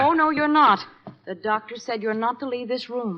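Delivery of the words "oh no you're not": loud, higher overruling cry; firm protest. Acted. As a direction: put your foot down. Forbidding wounded man to leave.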